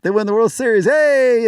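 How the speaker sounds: high-pitched celebratory whoop; mock fan jubilation, ending on a high "Hey!"; bandwagon cheer. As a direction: cheer like a brand-new fan, pitch soaring.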